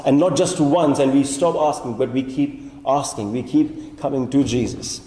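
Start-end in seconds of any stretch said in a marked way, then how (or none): none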